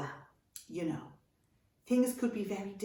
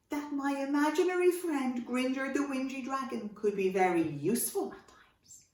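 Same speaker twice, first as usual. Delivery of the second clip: plain reading voice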